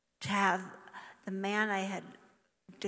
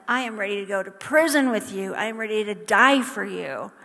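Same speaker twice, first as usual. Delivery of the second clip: louder, proud vow; bold declaration; 8 dB louder than usual. Retold or imitated. Peter boasting to Jesus, a loud proud vow. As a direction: proclaim it with confident bravado.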